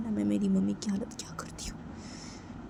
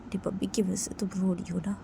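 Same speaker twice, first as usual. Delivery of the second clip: ordinary delivery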